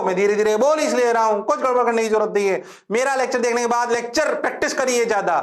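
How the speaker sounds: high-pitched, insistent lecturing; teacher's scolding. Real, not acted. Teacher scolds students about revising.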